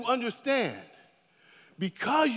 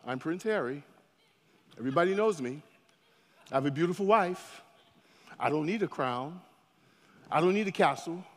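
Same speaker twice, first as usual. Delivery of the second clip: lower, calm, self-assured; confident prince voice; 5 semitones lower, 4 dB quieter, faster than usual. Retold or imitated. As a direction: say it like a prince who needs nothing. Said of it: "he's walking around".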